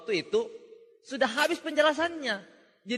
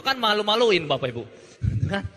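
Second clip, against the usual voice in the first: normal talking voice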